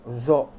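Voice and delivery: "Zo" loud, lips pouted; emphatic rounded "Zo". Shown as a demonstration. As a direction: push lips forward on "Zo". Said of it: "Zo harus monyong juga".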